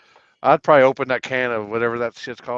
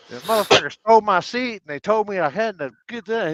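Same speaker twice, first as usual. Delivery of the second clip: imitating Matt's complaint; 8 semitones higher than usual. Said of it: "he was like".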